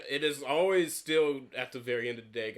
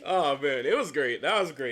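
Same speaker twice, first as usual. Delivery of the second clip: normal talking voice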